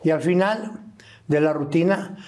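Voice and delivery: normal talking voice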